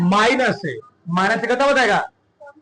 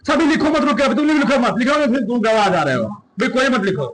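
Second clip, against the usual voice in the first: usual speaking voice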